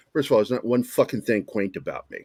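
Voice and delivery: usual speaking voice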